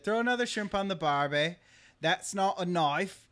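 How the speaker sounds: a put-on Australian twang; Australian accent. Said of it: "horribly offensive stereotypes".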